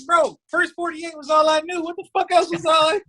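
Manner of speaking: very high, strained pleading defence; comic exasperated squeal; frantic protest. Retold or imitated. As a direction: replay a panicked excuse, voice way up. Acted protest to lawyer.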